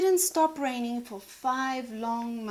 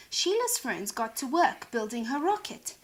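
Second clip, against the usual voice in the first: ordinary delivery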